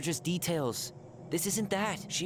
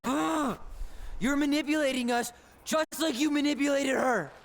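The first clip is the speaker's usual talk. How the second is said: loud, higher, furious; angry; 7 semitones higher, 4 dB louder than usual. Acted. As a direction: shout the accusation. Angry accusation in quarrel.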